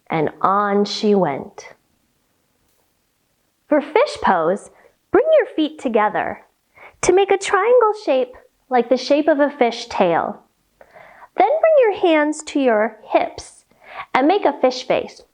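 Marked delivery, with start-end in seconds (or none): none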